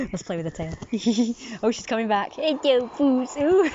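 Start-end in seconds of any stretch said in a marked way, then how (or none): 2.3-3.7 cat talk. Real, not acted. Cooing at a cat.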